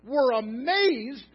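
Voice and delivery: from firm to loud and high preaching; sermon rising to a peak; building preaching. Acted. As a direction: climb to a shouted authority. Preaching builds to full force.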